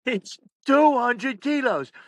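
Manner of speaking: high, slow mock exasperation; fed up. Announcer repeating himself to the coach, fed up. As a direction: spell it out slowly, out of patience. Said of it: "Dave had enough".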